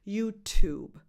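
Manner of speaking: "YouTube" with "Tube" starting like the number two; loud "YouTube", saying "two" plus B. Shown as a demonstration. Teacher giving her best tip, "YouTube" built on "two". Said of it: "as the number two".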